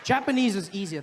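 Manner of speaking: normal talking voice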